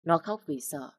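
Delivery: ordinary delivery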